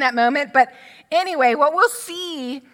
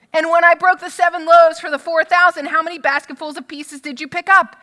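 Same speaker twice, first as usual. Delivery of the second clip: pressing question; 5 semitones higher, 6 dB louder than usual. Retold or imitated. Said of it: "barrage of questions".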